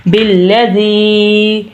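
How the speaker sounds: "بالذی" slow with "ذی" held long; elongated recitation. Shown as a demonstration. Teacher showing a long vowel, elongated recitation. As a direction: stretch the final ee of "بالذی". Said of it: "حرکات ہوتی ہیں جو لمبی کرنے والی".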